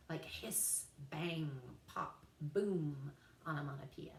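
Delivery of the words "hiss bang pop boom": drawn-out hiss in HISS, low resounding BOOM; sound-word demo. Shown as a demonstration. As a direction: say hiss, bang, pop, boom like the noises they name. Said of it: "words that sound like their meanings".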